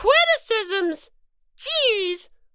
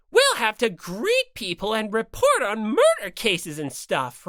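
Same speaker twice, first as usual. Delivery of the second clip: lower, self-important producer-speak bear; low showbiz mascot; 8 semitones lower than usual. Acted. Bear mascot explaining TV demographics, low and pompous. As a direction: lecture like a TV bigshot. Second character lecturing on demographics.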